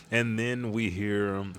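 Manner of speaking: usual speaking voice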